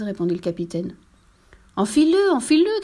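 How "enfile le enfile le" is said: high, loud, rising pleading call; eager cry. A narrator's reading. Fifi begging her father to put on the royal outfit, eager cry. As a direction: bounce with impatience. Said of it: "cria Fifi".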